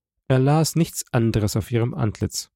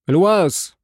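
alarmed, raised call of a name; 7 semitones higher, 5 dB louder than usual. A narrator's reading. Husband calls through his wife's bolted door, calling out in alarm. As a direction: call out higher and louder, shuddering. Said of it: "blieb er stehen und rief".

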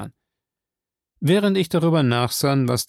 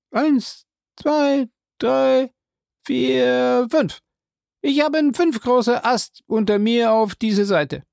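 Jupiter's voice; 11 semitones higher than usual. Acted. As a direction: pitch him well up and call out. Jupiter counting the branches.

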